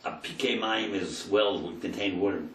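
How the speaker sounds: normal talking voice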